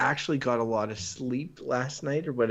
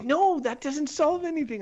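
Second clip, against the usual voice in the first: quoting his wife; 14 semitones higher than usual. Retold or imitated. Wife voiced, retold scolding.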